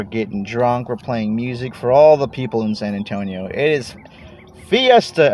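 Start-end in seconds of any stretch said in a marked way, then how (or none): 4.7-5.3 higher, louder Fiesta cheer; excited. Real, not acted. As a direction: call it out with joy. Celebrating Fiesta.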